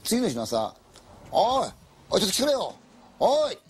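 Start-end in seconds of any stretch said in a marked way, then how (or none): 1.3-3.6 calling out loud and high, alarmed; mock yell for the others to come; frightened friend shouting from the balcony; retold shout. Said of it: "叫んでる".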